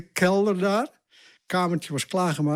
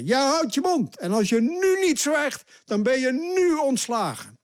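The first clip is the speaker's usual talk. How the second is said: retold shout; 10 semitones higher than usual. Minister threatening to fire the official, shouting. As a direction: yell the threat of firing straight at him. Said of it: "schreeuwde die naar mij".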